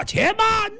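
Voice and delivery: sudden high ringing shout; shouted "wait". Acted. A minister stopping the king's suicide, piercing shout. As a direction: cut in with a piercing cry to stop him. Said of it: "有人大喊一声".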